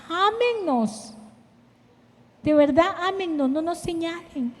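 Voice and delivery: soft pleading appeal. Real, not acted. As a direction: beg them gently to love women. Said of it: "pleading to the congregation".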